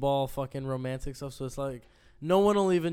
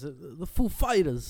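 slow, halting Walken cadence; Christopher Walken impression; 3 dB louder, slower than usual. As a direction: drag out [name] like Walken. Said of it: "Like Christopher Walken says".

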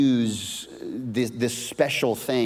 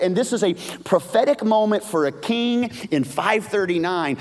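higher, louder, full-force preaching; fiery preaching; 9 semitones higher, 5 dB louder than usual. Acted. Preacher builds to a passionate climax about God's emotion. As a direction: preach it with everything.

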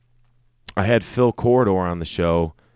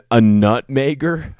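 incredulous; 7 semitones higher, 6 dB louder than usual. Real, not acted. Incredulous at odd name.

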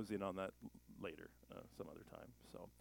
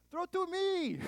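put-on shouting to the tour guide in a Middle Eastern accent; 6 dB louder than usual. Preacher telling the tour guide to throw the ball, in a Middle Eastern accent. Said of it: "if I say it with an accent".

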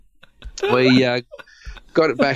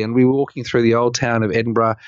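ordinary delivery

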